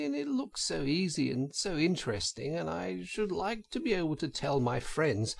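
high, soft wheedling plea; coaxing washerwoman. A narrator's reading. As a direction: Toad sweetly begging to drive. Toad in disguise asks driver.

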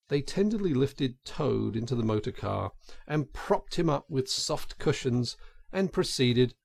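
ordinary delivery

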